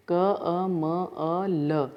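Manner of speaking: क, अ, म, अ, ल drawn out separately; sound by sound. Shown as a demonstration. Teacher splitting a word for students, sound by sound. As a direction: split कमल into slow, isolated sounds क, अ, म, अ, ल. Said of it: "इसको विच्छेद".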